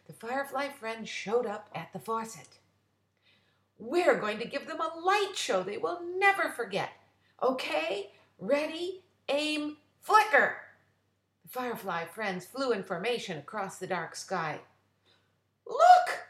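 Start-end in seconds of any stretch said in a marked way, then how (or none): 3.8-10.6 high, loud rallying call; a firefly rallies his friends, building to the cue; eager leader. Acted. Francis gives his friends the signal, loud and eager. 15.7-16.3 very high, loud delighted shout; excited cry. Acted. Frida spots the light show, crying out in delight. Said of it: "cried Frida".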